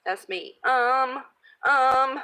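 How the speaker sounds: normal talking voice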